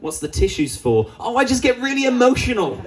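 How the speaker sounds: sarcastic high mocking voice; high whiny sarcasm mocking the ad guy. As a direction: scoff at the advert in a high put-on voice. Mocking the advert man.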